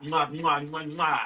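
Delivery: blowing kisses. Real, not acted. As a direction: smack kisses to the camera.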